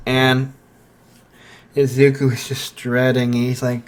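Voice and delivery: ordinary delivery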